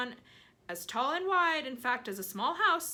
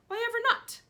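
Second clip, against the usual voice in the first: indignant; 8 dB louder, faster than usual. Acted. Aunt indignant.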